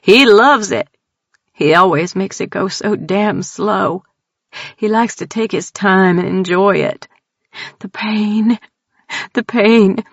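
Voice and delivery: put-on scared country girl blurting out her terror; frightened Texan woman. Tildy tells strangers about the clown, high frightened Texan voice.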